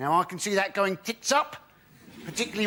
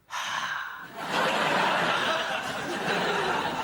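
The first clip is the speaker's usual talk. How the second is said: long, breathy exhale, like a dirty phone call; heavy breathing; 6 semitones higher than usual. Acted. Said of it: "I like to go".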